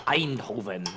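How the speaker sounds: put-on Eindhoven said the Dutch way in a light Dutch accent; a touch of Dutch accent on the city name. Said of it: "I don't know why I said it in that accent".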